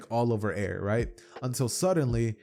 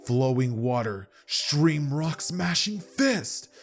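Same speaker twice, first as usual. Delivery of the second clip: anime attack call; 4 semitones higher, much slower than usual. Acted. Garo calls out his attack move.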